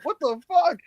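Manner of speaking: mock disbelief. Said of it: "I'm like".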